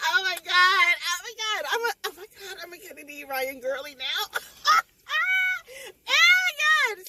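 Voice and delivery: high, loud fangirl squealing with a crying edge; a reader freaking out over a new favourite author, squealing; overwhelmed squeal. Real, not acted. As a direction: gush like you can't contain it. Gushing over the book.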